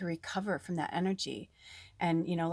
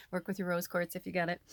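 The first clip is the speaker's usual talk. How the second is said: normal talking voice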